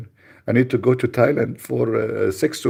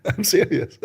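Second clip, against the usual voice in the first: laughing; 11 semitones higher than usual. Real, not acted. Laughs at own story.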